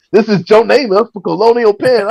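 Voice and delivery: high mock TV pitchman; hammed-up insurance ad spokesman; Joe Namath. Comic spoofing an old celebrity's insurance ad, high pitchman voice. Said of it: "he's gonna be selling, like, reverse mortgages".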